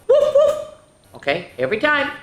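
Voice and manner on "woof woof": high, loud playful woof; puppy bark. Shown as a demonstration. Teacher showing kids the puppy bark, high and loud. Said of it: "you have to go".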